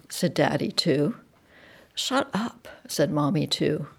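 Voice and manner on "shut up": soft, higher-pitched shush; quiet, tense telling-off; hushed rebuke. A narrator's reading. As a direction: shut them up under your breath. Hushed snap between the children.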